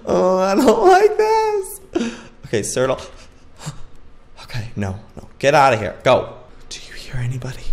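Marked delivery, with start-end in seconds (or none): 0.0-2.1 slow, high, drawn-out whine; dread. Real, not acted. Dread after a scare. 6.7-7.6 hushed, wary whisper. Real, not acted. Listening at the door.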